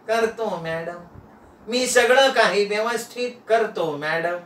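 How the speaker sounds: meek, spellbound houseboy; entranced servant. Acted. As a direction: promise obediently, as if hypnotised. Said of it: "चॉकलेटच्या प्रभावानं नाना बोलायला लागले".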